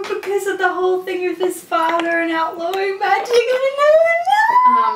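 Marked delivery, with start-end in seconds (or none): 3.3-4.8 mock tearful wail. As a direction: protest rising to a high wail. Listener wails over sad story.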